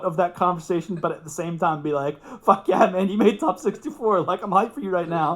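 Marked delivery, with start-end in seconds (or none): 2.4-5.3 high, loud, laughing hype; hyped congratulations. Retold or imitated. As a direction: pump him up for making top sixty-four. Hyping up Chris after win.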